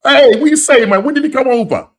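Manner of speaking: high, loud, animated greeting; big, high-pitched burst of surprise; excited disbelief. Real, not acted. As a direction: call out in delighted disbelief to a long-lost mate. Surprised to find viewer lives nearby.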